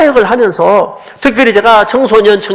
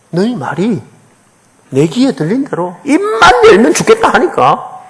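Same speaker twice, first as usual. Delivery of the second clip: ordinary talk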